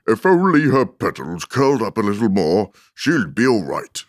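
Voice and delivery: a hint of an opinionated flower; lightly voiced Tiger Lily. A narrator's reading.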